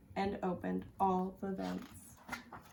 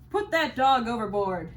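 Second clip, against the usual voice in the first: captain's order; 2 semitones higher, 8 dB louder than usual. A narrator's reading.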